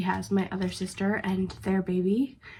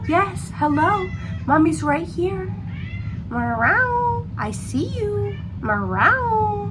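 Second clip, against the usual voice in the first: soothing a pet; 12 semitones higher, 3 dB louder, much slower than usual. Real, not acted. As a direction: coo to the cat in a high voice. Talking sweetly to the cat.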